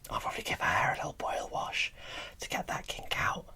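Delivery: ordinary talk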